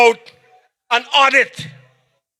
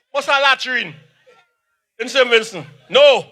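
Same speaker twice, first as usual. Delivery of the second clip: normal talking voice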